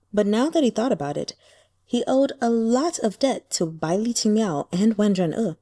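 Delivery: ordinary delivery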